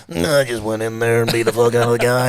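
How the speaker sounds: mild American fighter accent, imitating a post-fight interview; lightly put-on American accent, blunt and proud; Don Fry accent. Host retelling Don Fry's post-fight interview, light American accent. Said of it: "he had this accent".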